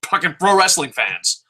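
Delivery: normal talking voice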